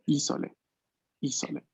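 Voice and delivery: "Isole" stressed on the opening I, then repeated; stress on first syllable. Shown as a demonstration. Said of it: "el acento recae en la primera".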